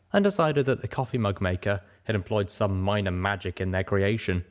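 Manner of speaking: ordinary delivery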